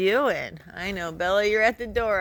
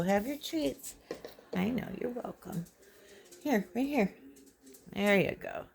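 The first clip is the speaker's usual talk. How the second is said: usual speaking voice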